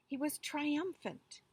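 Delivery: plain reading voice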